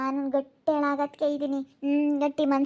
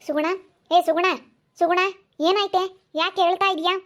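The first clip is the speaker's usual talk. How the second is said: raised, higher calling voice; 4 semitones higher, 4 dB louder than usual. Acted. Neighbor calls to the crying daughter, loud and high. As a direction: call her name across the yard, loud and high.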